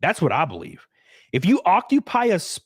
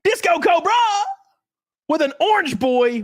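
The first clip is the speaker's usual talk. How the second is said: hype shoutout; 12 semitones higher, 3 dB louder than usual. Real, not acted. Reading a donation name.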